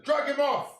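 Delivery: angry shout. Real, not acted. Fan yelling at Arteta to sub the player off, angry shout. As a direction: yell for the substitution.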